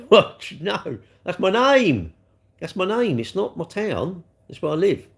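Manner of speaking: incredulous, pitched well up; exasperated correction. Real, not acted. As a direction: insist on it, stretching the words. Correcting someone mistaking his name again.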